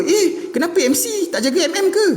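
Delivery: high mocking voice quoting followers; mock whiny question. Mocking his followers' silly question, high and laughing. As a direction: sneer it like a clueless follower.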